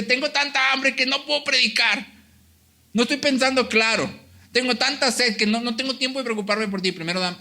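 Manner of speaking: mock whiny complaint. Preacher voicing a selfish Jesus to the congregation, hammed-up whine. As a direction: whine about hunger like a spoiled preacher. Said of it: "él no dice".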